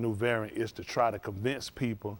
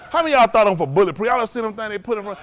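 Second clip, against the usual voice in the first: fast, loud, high and laughing; laughing rowdy question; 8 semitones higher, 10 dB louder, faster than usual. Real, not acted. To the listeners about teleprompters, laughing loud and fast. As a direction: tease the crowd with a big laugh. Asks crowd about bulletproof glass.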